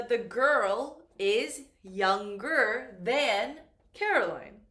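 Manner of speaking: very slow and loud, each word set apart; teacher reading an example sentence slowly; slow model sentence. Shown as a demonstration. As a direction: dictate it slowly for the class. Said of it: "for example".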